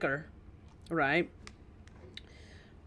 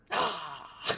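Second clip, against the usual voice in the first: spooky shriek. Acted. Spooky character entrance.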